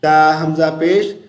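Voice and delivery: ordinary talk, nothing marked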